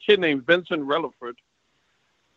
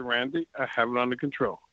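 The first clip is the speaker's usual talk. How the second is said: softer, lower, firm repeat; calm him down, lower and softer; telling Randy again, quieter and lower; quieter insisting; 5 semitones lower, 8 dB quieter than usual. Retold or imitated. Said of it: "I said".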